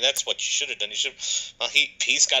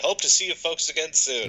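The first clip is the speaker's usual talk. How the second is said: higher, bright mock funeral-director greeting; cheery funeral director; 6 semitones higher than usual. Funeral director greeting old mourners, cheery mock voice. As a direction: play the oblivious friendly undertaker. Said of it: "funeral directors accidentally said".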